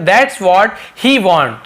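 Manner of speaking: every word stretched; very slow model sentence. Shown as a demonstration. Teacher slowly giving the he-version to students. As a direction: say it slowly for beginners.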